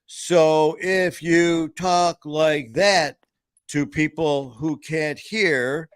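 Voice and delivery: slow, high, over-enunciated; mock slow loud talk. Shown as a demonstration. Mimicking how Kat talks to the deaf dog, slow and high. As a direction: drag it out like for the deaf. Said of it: "why are you talking like that".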